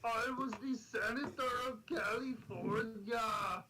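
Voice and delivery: bad Schwarzenegger Austrian accent; Arnold impression. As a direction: drag it out in a thick Austrian accent. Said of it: "how bad is my Arnold impression".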